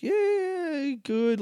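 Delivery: imitating a reluctant, half-hearted reply; high, soft, drawn-out yeah and a weak good; mock-unconvincing answer, trailing down from high. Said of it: "he gave some half-hearted good".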